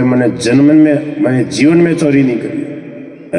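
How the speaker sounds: normal talking voice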